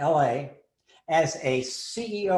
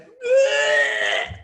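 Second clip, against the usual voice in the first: sudden loud high gagging "ugh"; retching gag; 8 dB louder than usual. Retold or imitated. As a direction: gag out loud like you're about to be sick. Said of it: "and she went".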